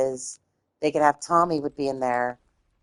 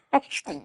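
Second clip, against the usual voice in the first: high, short real laugh; suppressed laugh; 10 semitones higher than usual. Real, not acted. Failing not to laugh at own impression, short high laugh. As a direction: crack up briefly.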